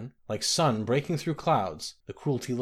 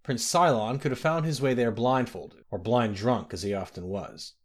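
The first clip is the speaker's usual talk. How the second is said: ordinary delivery, nothing marked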